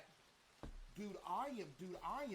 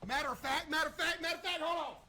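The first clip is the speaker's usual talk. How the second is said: building hype; 9 semitones higher, 9 dB louder, faster than usual. Real, not acted. Hyped streamer showing off character.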